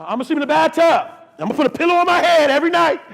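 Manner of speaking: high, loud plea building to a full shout; desperate mock shouting. Hammed-up cry from man in bathtub.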